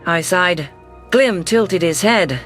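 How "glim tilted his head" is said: ordinary delivery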